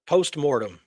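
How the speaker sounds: usual speaking voice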